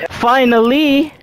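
high and slow, drawn out; stretched high exclaim. Real, not acted.

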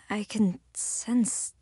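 usual speaking voice

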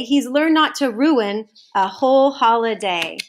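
usual speaking voice

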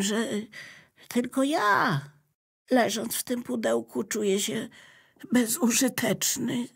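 high, wistful notebook voice; the notebook admits feeling useless, wistful; mildly sad notebook. Acted.

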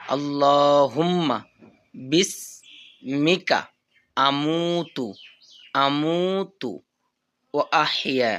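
drawn-out chanted dua; slow recitation. Shown as a demonstration. Teacher reciting for students to follow, slowly. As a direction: recite slowly, syllables stretched. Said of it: "আবার revise দিই।".

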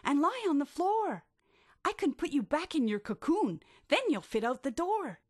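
high, bright urging; a keen friend's high call; eager instruction. Acted. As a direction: coax him with a lively plan. Shelley explaining her plan.

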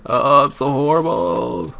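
slow, high-pitched mock lament; mock wail. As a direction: drag it out in a playful high whine. Mock lament after burning food.

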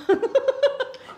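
loud, high laugh; high burst of laughter. Real, not acted. Teasing about coq au vin.

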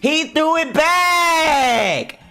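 high, slow, stretched shout of disbelief; stunned shout. Real, not acted. Reacting to a bullet thrown back, stunned high shout. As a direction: yell it out, laughing in shock. Shocked reaction to anime fight.

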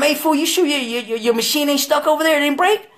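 impatient question. Retold or imitated. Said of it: "I'm calling him".

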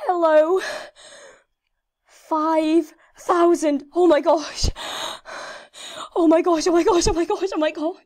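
breathless awe tumbling into excitement; excited milestone cheer. Real, not acted. Gamer reaching a milestone level.

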